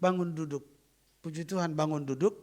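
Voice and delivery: usual speaking voice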